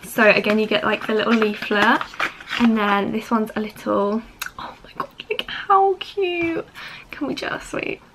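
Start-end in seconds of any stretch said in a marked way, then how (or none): 4.6-8.0 breathless awed whisper, high; excited whisper. Real, not acted. Vlogger to viewers over a tiny brush, excited whisper. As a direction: hush it like you can't believe how cute it is.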